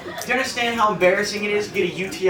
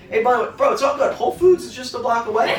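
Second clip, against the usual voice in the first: higher, bro-ish taunt from a court guy; mock-friendly trash talk; 6 semitones higher, 4 dB louder than usual. A Harlem player taunting the comic with mock friendliness. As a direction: play the Harlem guy taunting him kindly. Trash-talker voiced in stand-up story.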